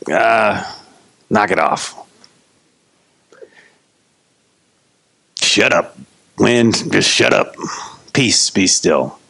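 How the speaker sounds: low, unhurried, unimpressed order; offhand weary command. Acted. Just-woken Jesus to the wind, low and unbothered. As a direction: casually tell it off, half awake. Sleepy Jesus casually silences storm.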